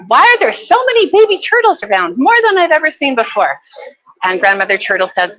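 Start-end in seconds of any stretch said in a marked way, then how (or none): 0.1-3.6 put-on much higher, wide-eyed child asking; curious child voice. A narrator's reading. A child at the sea bottom asks Grandmother Turtle, high and wondering. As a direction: pitch it up like an amazed little kid. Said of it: "the child says".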